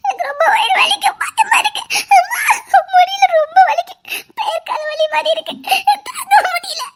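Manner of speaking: high, loud sobbing wail; wailing in pain. Acted. Pregnant wife telling her husband the pain is unbearable, wailing. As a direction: cry out each word as the pain hits.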